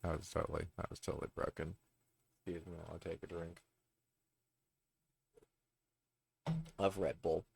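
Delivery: soft, off-mic muttered comment; muttered aside. Real, not acted. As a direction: drop out of the reading and mumble to yourself. Off-mic aside before a drink.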